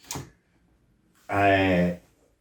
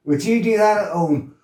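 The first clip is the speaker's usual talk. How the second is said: scolding manager; 7 semitones higher than usual. Retold or imitated. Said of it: "this voice".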